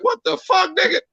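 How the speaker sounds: high, startled outburst; shocked at finding brother home; surprised exclaim. Retold or imitated. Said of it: "I'm like".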